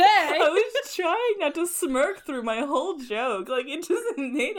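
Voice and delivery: slow words pushed through real laughter; fighting giggles while explaining the joke; laughing. Real, not acted. Caught smirking at her own joke, laughing. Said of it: "Did you say Hershey?".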